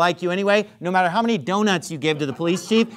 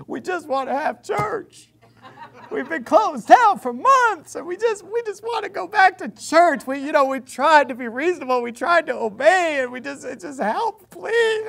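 hammed-up sob-story voice; mock crying plea; 9 semitones higher than usual. Preacher mocking a pastor's tearful video, high whiny mock plea. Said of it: "practically crying on camera saying".

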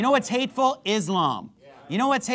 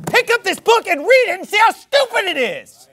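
high, loud, shouted preaching; preaching at full force; 11 semitones higher, 7 dB louder than usual. Acted. Preacher shouting at his congregation, full-force preaching.

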